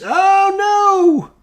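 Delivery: high, drawn-out squeal of alarm; mock dread. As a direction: wail in playful dismay. Dreading the game's roll.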